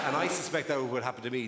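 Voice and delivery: normal talking voice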